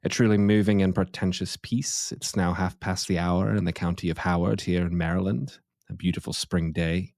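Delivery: put-on British radio presenter; pretentious British accent. Host introducing the show after some classical music, in a pretentious British accent. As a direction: affect a pompous British accent. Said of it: "pretentious British accent".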